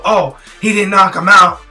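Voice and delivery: normal talking voice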